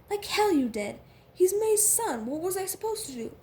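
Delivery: loud, high-pitched protest; heated arguing. A narrator's reading. Couple quarrelling over letting Nate stay, heated arguing.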